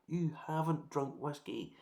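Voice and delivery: ordinary talk, nothing marked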